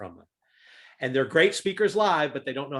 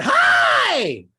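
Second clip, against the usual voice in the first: much higher, loud hi; a blast of a greeting; over-the-top cheery hi; 10 dB louder than usual. Retold or imitated. Speaker recalls greeting the Zoom audience, over the top. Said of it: "when I said that".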